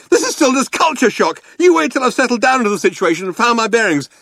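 high, rushed, hysterical retort; snapped. A narrator's reading. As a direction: argue back frantically. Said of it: "snapped Arthur".

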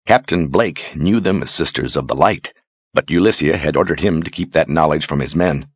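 plain reading voice

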